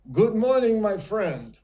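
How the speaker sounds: imitated market-stall greeting; friendly merchant greeting. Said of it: "the Portuguese merchant at the vegetable stand".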